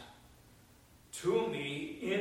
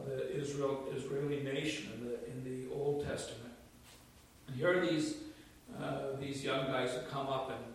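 ordinary talk

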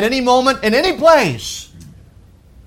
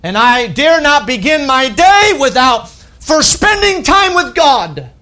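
raised, higher and louder preaching shout; preaching at full force; 5 semitones higher, 4 dB louder than usual. Real, not acted. Preacher declaring his devotion to the congregation, full-voiced shout. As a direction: build to a fervent shout.

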